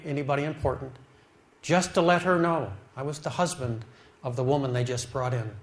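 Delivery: ordinary talk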